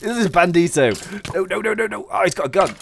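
loud, high gamer panic; panicked yelling. Real, not acted. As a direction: shout it out as the attack comes. Under attack in game.

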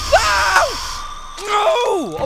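high, loud shouted no; frightened shouts. Real, not acted. Gamer caught by the monster, shouting high and loud. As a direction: yell it out as the monster grabs you. Failed jump, monster attack.

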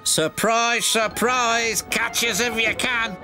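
high-pitched, mischievous sing-song; runaway trucks crowing; gleeful taunt. A narrator's reading. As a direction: dare him to chase, in a high voice.

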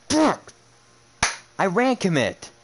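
a high, loud, real yell of rage; furious yell. Real, not acted. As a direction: blow up at the lucky play. Rage at an unlucky play.

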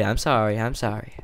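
ordinary talk, nothing marked

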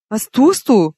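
loud, startled horrified question. A narrator's reading. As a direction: blurt it out in shock. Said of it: "fragte ich entsetzt".

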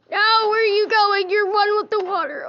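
high-pitched worried disbelief; alarmed cry. Real, not acted. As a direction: call out in alarm as he sinks. Buddy sinking into the water.

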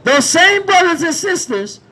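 loud, driving sermon shouted to the street; full-force street preaching. Acted. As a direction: preach it out over the crowd.

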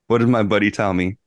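ordinary delivery

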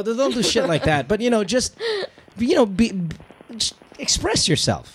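normal talking voice

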